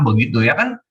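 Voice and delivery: ordinary talk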